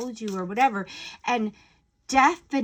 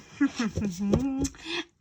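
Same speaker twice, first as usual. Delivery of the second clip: smug little chuckle; 3 semitones higher than usual. Retold or imitated. Smirking gossiper.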